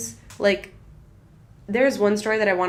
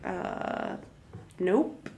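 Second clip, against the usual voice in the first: dismissive employer; 7 dB quieter, much slower than usual. Acted. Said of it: "they'd be like".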